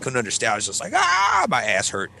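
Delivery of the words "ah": high, drawn-out "ah" of pain; hammed-up high wail; mock pained cry. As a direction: yelp like a spanked kid. Kid whacked in the retelling.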